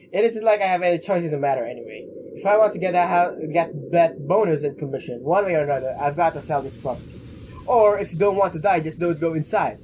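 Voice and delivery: ordinary talk, nothing marked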